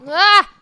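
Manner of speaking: sharp startled cry from a slap; startled yelp. Real, not acted. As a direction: yelp out, stung awake. Slapped awake.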